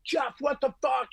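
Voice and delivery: high, drawn-out what the fuck; pleading, exasperated retold complaint; retold exasperation. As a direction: imitate yelling up at the sound guy. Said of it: "and I'm like".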